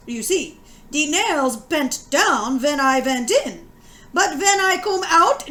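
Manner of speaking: story read in a light German-American dialect voice. Acted. Teacher reads the class a tale, lightly German-American dialect. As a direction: tell it in a gentle German-American immigrant dialect. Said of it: "having heard this dialect".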